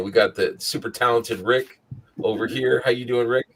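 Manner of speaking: ordinary delivery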